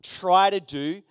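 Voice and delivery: emphatic, stretched-out "Try"; stress on Try. Real, not acted. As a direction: lean hard and slowly on "Try". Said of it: "I emphasize the word try".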